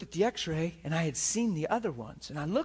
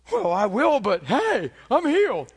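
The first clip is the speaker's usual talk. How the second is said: loud, high, re-enacted excitement; triumphant retold outburst; exuberant announcement; 6 semitones higher, 6 dB louder than usual. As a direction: proclaim it to everyone, giddy and loud.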